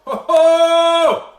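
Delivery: high loud gasp of delight; delighted surprise. Real, not acted. Host tasting the wine on camera, delighted outburst. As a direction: burst out in delight at the taste.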